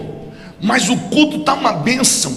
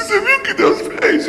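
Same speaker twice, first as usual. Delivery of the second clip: much higher, tearful mock-crying voice; imitating crying; 14 semitones higher than usual. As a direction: replay your own tears in a high squeak. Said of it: "feio demais chorando".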